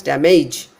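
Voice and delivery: damage as da-MAYJ, long ending; stress on second syllable. Shown as a demonstration. Teacher showing wrong stress, damage as da-MAYJ. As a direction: push the stress onto MAGE in damage. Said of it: "If I give stress on the second syllable".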